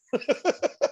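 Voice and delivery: high-pitched giggling; real high, breathless laugh. Real, not acted. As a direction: crack up in a high cackle. Cracks up at failed accents.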